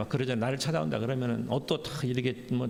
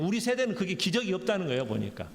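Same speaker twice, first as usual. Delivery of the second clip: ordinary talk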